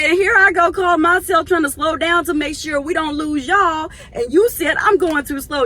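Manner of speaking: high, loud mock complaint; hammed-up indignant retelling; exasperated retelling. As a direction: protest it with big exasperation. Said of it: "I said".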